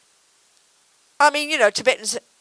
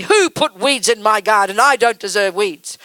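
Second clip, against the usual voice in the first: slow, louder put-on outrage; mock indignation; 6 dB louder, slower than usual. Gardener complaining about the weeds, mock indignant. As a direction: play the entitled gardener, affronted. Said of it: "look in your garden and say".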